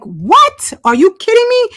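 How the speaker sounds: high, incredulous retelling; mock-outraged disbelief. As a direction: replay your shocked reaction. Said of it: "I'm like".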